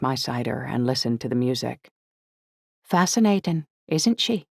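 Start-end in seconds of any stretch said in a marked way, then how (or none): 2.8-4.5 put-on higher, lilting young woman's voice; light feminine character voice. A narrator's reading. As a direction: pitch it up for a girl chiming in. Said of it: "came a feminine voice at my elbow".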